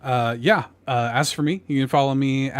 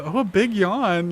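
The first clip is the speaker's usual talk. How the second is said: slow, higher doting parent voice; parent cooing; 6 semitones higher, slower than usual. Retold or imitated. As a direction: coo it like a parent to a toddler. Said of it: "like, a parent".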